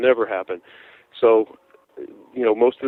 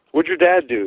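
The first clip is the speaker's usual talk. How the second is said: higher, sharp retold demand; mock grilling a young fan; retold scolding; 6 semitones higher than usual. Musician scolding a kid fan, retold demand. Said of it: "I was, like, laying into the kid".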